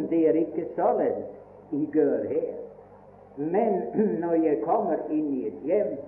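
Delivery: usual speaking voice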